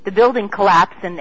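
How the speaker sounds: usual speaking voice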